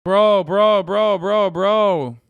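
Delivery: loud shocked bro bro; loud repeated disbelief; loud exclamation. Real, not acted. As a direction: call it out in real shock. Reacting to the explosion footage.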